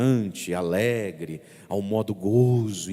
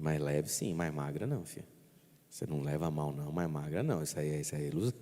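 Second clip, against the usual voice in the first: gentle teasing; 5 semitones lower, 9 dB quieter, faster than usual. Retold or imitated.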